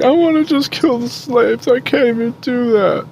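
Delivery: put-on high, drawn-out whiny kid wail; hammed-up tearful whine, held high; mock crying. Game master mimics crying kid who wants to kill, high mock crying. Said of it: "he's, like, crying".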